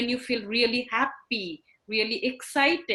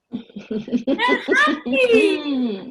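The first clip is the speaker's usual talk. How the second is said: very slow, higher, louder with a laugh; delighted; 8 semitones higher, 7 dB louder, much slower than usual. Real, not acted. Teacher praising children hugging parents, laughing delight. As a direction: beam with joy as you say it.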